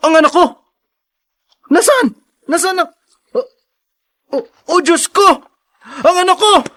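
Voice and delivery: put-on frantic as he realizes the child is gone; panicked cries. Father finds baby missing. (natatarantang sigaw para sa anak)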